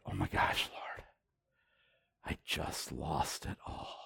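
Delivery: whispered horror at losing it all; stunned whisper. Retold or imitated. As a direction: barely breathe it out in shock. Horrified realization in prayer.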